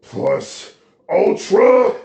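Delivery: huge, drawn-out high shout; all-out heroic yell; battle cry. A narrator's reading. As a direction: bellow it slowly, soaring upward. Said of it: "Taking a deep breath, he then says".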